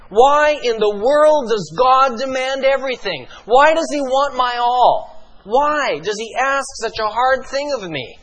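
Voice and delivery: a hint of a complaining believer; pleading objector.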